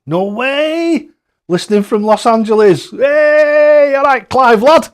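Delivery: excited greeting. Real, not acted. Streamer greets listener Clive listening from Los Angeles, thrilled and loud.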